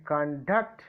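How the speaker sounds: con-DUCT, weight on the end; light "con", then hit DUCT in conduct; stress on second syllable. Shown as a demonstration. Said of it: "second syllable of the first letter".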